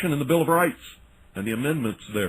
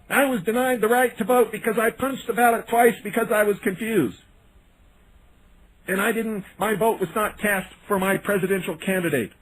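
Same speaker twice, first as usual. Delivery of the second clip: mocking confused voter; 7 semitones higher than usual. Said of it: "these poor people down in Florida saying".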